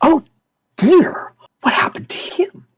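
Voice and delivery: breathy, high, dithering nurse voice. Acted. Nurse walks over to the hurt man, fussing. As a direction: fuss over the patient in a thin, airy voice. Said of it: "Nurse Harper walks over and she goes".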